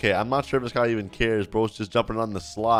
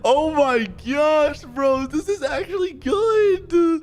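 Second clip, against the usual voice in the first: very high, drawn-out excited squeal; thrilled disbelief; 13 semitones higher, slower than usual. Real, not acted. Streamer amazed the test is actually working, squealing. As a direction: stretch it out, high and overjoyed.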